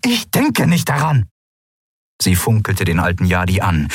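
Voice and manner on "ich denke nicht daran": angry refusal. Acted. Ruler refusing angrily.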